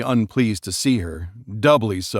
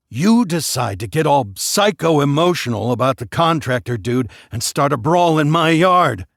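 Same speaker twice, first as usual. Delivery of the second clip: louder, heated rant; angry accusation; 4 semitones higher, 4 dB louder than usual. Acted. Furious husband blaming his wife, heated. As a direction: jab each charge at her.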